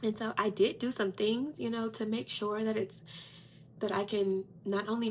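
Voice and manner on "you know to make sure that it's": ordinary talk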